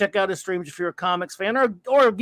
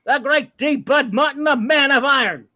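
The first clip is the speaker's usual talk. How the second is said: loud, raised hype introduction; announcer-style shout-out; 6 semitones higher, 5 dB louder than usual. Pretend. Host introduces a viewer in the chat, big announcing voice. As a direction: hail him like a champion entering.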